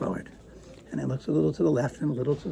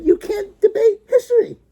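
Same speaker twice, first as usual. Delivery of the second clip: much higher, louder declaration; imitating the judge's ruling; mock emphatic verdict; stern judge; 8 dB louder than usual. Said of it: "And the judge said".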